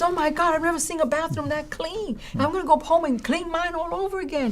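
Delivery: put-on high, excited voice of a young man stunned by a clean bathroom; amazed student. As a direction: play the student raving in disbelief. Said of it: "He says".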